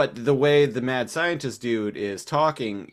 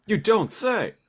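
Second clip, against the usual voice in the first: loud, high, slower mock yell; meme shout; 11 semitones higher, 6 dB louder than usual. Quoting a meme to co-host, high mock yell. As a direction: ham up the meme.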